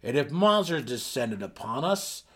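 loud, menacing threat; menacing boast. Acted. Burrow Warden warning of monsters, loud and menacing. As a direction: wave the pickaxe and threaten loudly.

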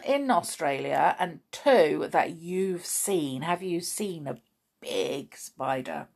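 ordinary talk

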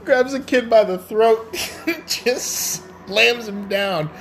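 high, slowed words broken by laughter; laughing retelling. Real, not acted. Host retelling the throat grab, laughing hard. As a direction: struggle to get it out through laughter.

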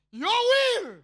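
high, loud crying plea. Acted. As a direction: cry it out to God. Servant's cry in prayer.

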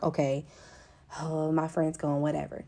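softly dismissive, put-on nonchalance; mock indifference. Mock indifferent reaction to loss.

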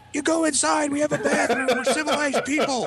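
high, strained imitation of an indignant father; scolding dad. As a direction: scold like an appalled dad, voice pushed way up. Retold dad scolding his son.

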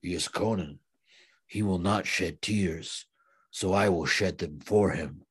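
a hint of a grave warrior's lament; solemn movie line. Subotai quoted, solemn movie line.

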